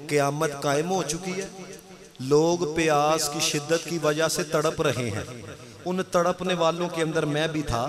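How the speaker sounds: low, soft narration of a holy tale; sermon storytelling. Real, not acted. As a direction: draw listeners in with a hushed, low voice. Preacher narrates a religious tale. (धीमी आवाज़ में दीनी क़िस्सा)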